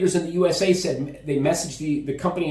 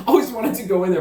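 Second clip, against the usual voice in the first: laughing; 7 semitones higher, 5 dB louder, faster than usual. Real, not acted. Still laughing after bar story.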